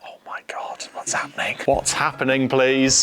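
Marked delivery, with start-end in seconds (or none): 0.0-1.6 breathy unvoiced whisper of disbelief. Real, not acted. Whispering in shock at his teammate's answer. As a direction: hush it, stunned.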